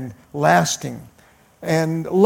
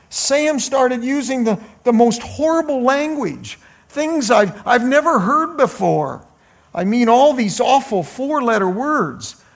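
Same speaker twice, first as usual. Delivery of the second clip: a high, pleading hint of tears; light tearful daughter begging mom; mock crying bride; 6 semitones higher than usual. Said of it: "she bursts out crying".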